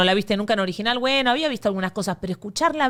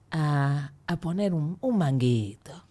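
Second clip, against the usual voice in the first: breathy seductive voice; 6 semitones lower, 5 dB quieter, slower than usual. Pretend. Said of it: "hago voz aireada que seduce".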